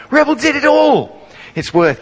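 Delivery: ordinary talk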